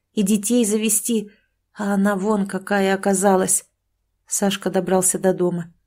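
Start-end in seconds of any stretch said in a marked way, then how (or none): none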